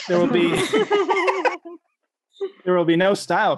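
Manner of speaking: breaking into laughter while reading; laughing. Real, not acted. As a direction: giggle through the line. Laughs mid poem.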